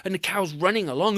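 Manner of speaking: ordinary delivery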